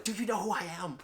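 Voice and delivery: high-pitched mock self-important demand; retold indignant outburst. Reviewer quoting an unhinged U.S. Agent, mock indignant. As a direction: put on an outraged, do-you-know-me voice.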